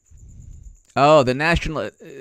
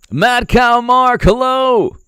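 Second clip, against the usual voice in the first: mock greeting; 11 semitones higher, 8 dB louder, slower than usual.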